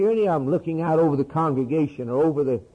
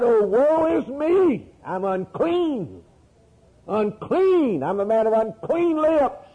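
high, slow, drawn-out lament; anguished cry; 6 semitones higher, slower than usual. Acted. Preacher voicing Isaiah who saw the Lord, anguished cry. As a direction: cry out in woe, stretching the words. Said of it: "I cried".

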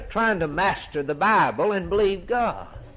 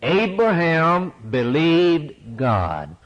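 slow, every word weighed, Abraham stressed; slow emphatic recital; 4 dB louder, much slower than usual. Shown as a demonstration. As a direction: lead the congregation word by word. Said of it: "Let's say it out loud".

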